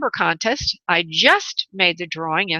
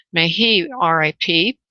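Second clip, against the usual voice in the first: usual speaking voice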